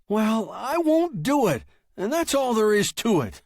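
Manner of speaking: high, loud, heated defiance; stubborn refusal. Acted. Writer refusing his machine's stories, loud and high. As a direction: put your foot down loudly.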